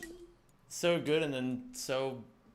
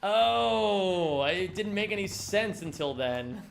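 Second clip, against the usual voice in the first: high, loud "oh" that then settles; exaggerated show of finally understanding; mock sudden realization; 4 semitones higher, 3 dB louder, slower than usual. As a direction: imitate someone suddenly getting it. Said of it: "They're like".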